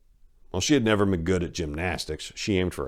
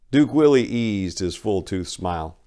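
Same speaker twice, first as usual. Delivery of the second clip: ordinary delivery, nothing marked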